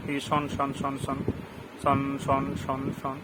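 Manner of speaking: শন said softly over and over to show the sh sound; repeating the sound shon. Shown as a demonstration. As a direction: drill শন as a soft sh ending. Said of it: "আছে না?". (শন শন নরম করে বারবার)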